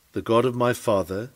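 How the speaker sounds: usual speaking voice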